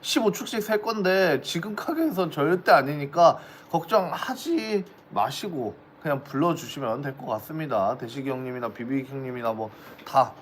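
ordinary talk

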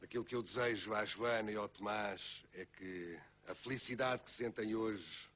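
ordinary talk, nothing marked

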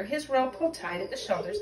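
plain reading voice

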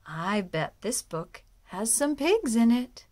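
ordinary delivery

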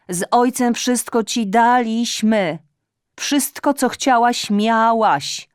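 high, loud reproachful tirade; scolding mother. Acted. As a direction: nag her like an aggrieved mother. (matka z pretensją podniesionym głosem)

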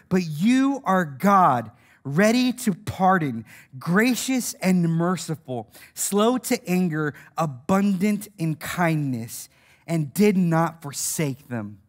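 low, measured scripture reading; slow solemn reading. Real, not acted. Solemn reading of a Bible verse.

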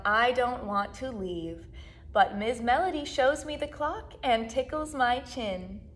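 ordinary delivery

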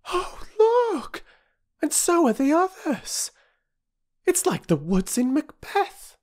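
very high, thrilled exclamations; amazed girl. A narrator's reading. Older sister watching trees walk, high and amazed. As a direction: gasp it out in wonder.

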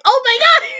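very high squeal, mimicking someone overexcited; imitated freak-out. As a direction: act out his squeaky fanboy panic. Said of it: "he freaked out".

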